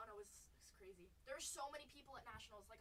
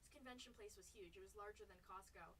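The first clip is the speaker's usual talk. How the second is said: ordinary talk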